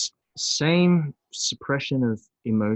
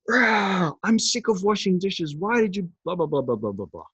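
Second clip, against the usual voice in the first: higher-pitched mock rant at a partner over the dishes; mock angry outburst; 7 semitones higher than usual. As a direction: act out a sudden exploding snap, a bit hammed-up. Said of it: "And it's this explosion".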